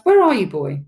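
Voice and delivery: loud father calling for his son; brisk searching shout. A narrator's reading. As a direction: raise the voice sharply, then drop it. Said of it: "he shouts".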